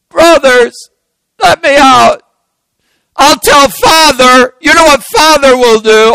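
high, loud pleading threat; crying out. Acted. Joseph threatening to tell Father from the pit, crying out. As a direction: yell up from the pit, desperate. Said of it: "he cries out".

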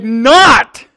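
"Not" thrown out hard and high; emphatic denial. Acted. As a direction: snap back a forceful "Not". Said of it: "Again, the same terminology".

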